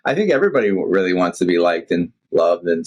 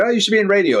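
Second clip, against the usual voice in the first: high, lightly voiced admiring fan; mock-gushing listener, pitched up; imitating fans; 12 semitones higher, faster than usual. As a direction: toss it up like an eager fan. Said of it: "And they say".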